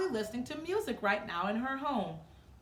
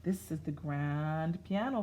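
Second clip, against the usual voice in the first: ordinary delivery, nothing marked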